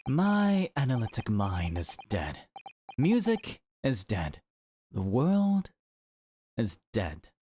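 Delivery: despairing Sholmes. Acted. Miserable detective declares everything dead, gloom breaking into an outburst.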